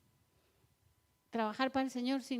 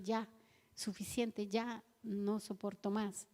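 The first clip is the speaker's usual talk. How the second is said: softer, lower, slow and exhausted; weary sigh; 3 semitones lower, 7 dB quieter, slower than usual. Retold or imitated. A tired servant giving up, soft and exhausted. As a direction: let it drain out of you.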